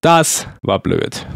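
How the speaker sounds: very slow, dragged-out annoyed repeat; slow drawn-out. Real, not acted. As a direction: stretch every word out in frustration. Repeats after getting caught.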